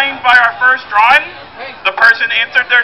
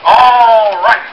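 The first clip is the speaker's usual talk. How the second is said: loud call; 7 dB louder than usual. Real, not acted. Calling the room to order.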